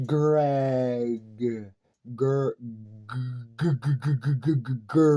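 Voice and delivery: low, stuttered g-, g-, g- before Greg; name broken into noise. Shown as a demonstration. Breaking his own name into sounds, low stutter. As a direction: stammer Greg into bare g sounds. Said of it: "listen to this".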